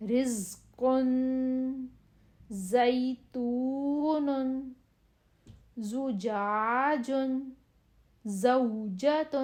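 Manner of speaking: slow, sharp ز drill; whistling z drill. Shown as a demonstration. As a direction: let each ز hiss clearly. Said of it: "اور جب ز آئے گا".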